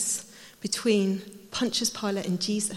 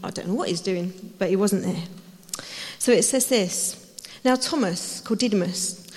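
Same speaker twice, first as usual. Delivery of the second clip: ordinary delivery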